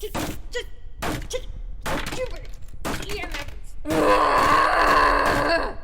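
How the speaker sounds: stammering then a loud cry on F; choked stammer bursting into a shout; strained outburst. Acted. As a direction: struggle to force the word out, then yell it. Straining to say EMF.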